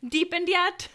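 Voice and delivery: put-on high, loud kid voice; high child voice, played for laughs; high-pitched boy. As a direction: pitch it up like an unbroken boy's voice. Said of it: "I have a really high-pitched voice".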